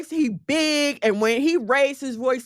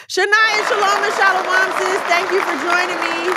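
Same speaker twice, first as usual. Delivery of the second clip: much higher, louder, bubbly welcome; light up and sing out the welcome; excited greeting; 8 semitones higher, 4 dB louder than usual. Real, not acted. Welcoming a viewer who just joined, excited and much higher.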